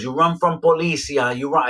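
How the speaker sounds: put-on a touch of a Spanish accent, excited; light Spanish accent. The man tells him he runs from police, light Spanish accent. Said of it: "He don't speak good English".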